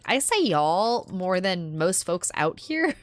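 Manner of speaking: normal talking voice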